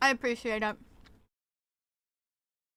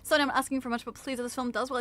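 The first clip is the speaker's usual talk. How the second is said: ordinary talk, nothing marked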